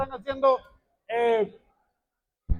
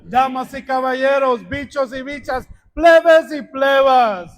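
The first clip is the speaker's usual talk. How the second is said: booming ring announcer shouting; lucha libre announcer; 8 dB louder, slower than usual. Acted. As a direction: stretch the words out and shout them. Ring announcer welcomes the crowd.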